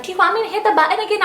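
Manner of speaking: quick, high nurse question. Retold or imitated. Nurse asking the patient, quick and high. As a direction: rattle it off as the nurse. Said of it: "the nurse is like".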